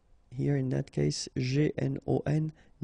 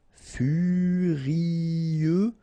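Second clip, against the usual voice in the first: "furieux" stretched in one long, higher line; drawn out; 4 semitones higher than usual. Shown as a demonstration. French teacher modelling for learners, "furieux" long and drawn out. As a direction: hold each vowel of "furieux".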